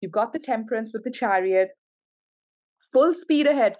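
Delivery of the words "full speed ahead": loud, high rallying call; rousing cheer. Real, not acted. Reader urging Capricorn forward, loud high rally. Hyping the listener on.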